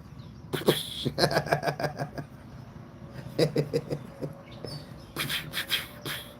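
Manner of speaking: long, airy, helpless laughing; breathless laughter. Real, not acted. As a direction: crack up quietly till out of breath. Cow's tail splashes everyone.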